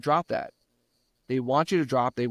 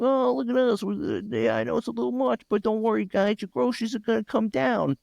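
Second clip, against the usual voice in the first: high, smug, falsely reassuring voice; mocking the news; 10 semitones higher than usual. Mocking how the news brushes off rising prices, high and sarcastic. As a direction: parody a cheerful official saying all is fine. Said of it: "in the news and look".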